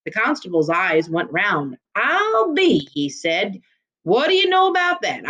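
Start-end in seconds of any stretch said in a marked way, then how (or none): 1.9-2.9 put-on a startled old lawman's I'll be; amazed. Constable to the stranger, caught off guard and amazed. 4.0-5.3 put-on a startled old lawman's I'll be; amazed. Constable to the stranger, caught off guard and amazed.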